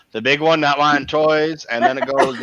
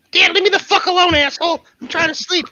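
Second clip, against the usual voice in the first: higher, fast cranky pup; grumpy dog voice; 14 semitones higher than usual. Acted. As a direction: voice the dog as a grouchy teen.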